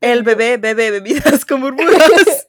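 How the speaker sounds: slow, laughing through the words. Real, not acted. Attempts tongue twister, laughing.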